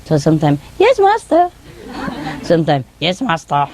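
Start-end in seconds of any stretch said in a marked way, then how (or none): 0.8-1.5 high-pitched imitation of a boy; boy's voice. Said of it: "say it in a boy's voice". 3.0-3.7 man's voice. Retold or imitated. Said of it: "say it in a man's voice".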